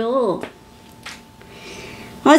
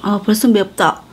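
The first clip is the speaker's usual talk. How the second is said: chewing while talking; eating spicy dumpling; mouth full of food. Real, not acted. Seen 3: Mouth works on food while she holds a bitten dumpling.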